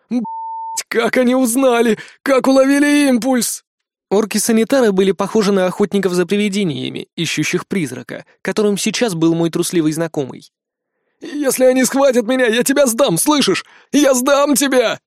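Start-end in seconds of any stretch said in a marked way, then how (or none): 0.1-3.6 panicked. Acted. Panicked elf swearing. 11.2-15.0 panicked threat. Acted.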